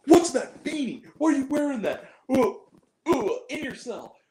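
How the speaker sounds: high-pitched imitation of a ranting bully; mock berating. Imitating the monster berating prisoners in their cells, mock rant. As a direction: berate everyone in a hammed-up high voice. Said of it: "it's just berating and, like, shoving people".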